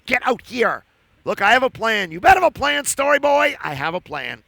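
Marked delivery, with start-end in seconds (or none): none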